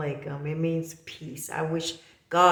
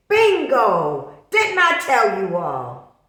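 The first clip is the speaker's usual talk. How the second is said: loud, higher, slow gloating; triumphant told-you-so; 4 semitones higher, 11 dB louder, much slower than usual. Retold or imitated. Telling viewers she predicted Brandy's move, loud triumphant gloat. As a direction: shout it like you called it.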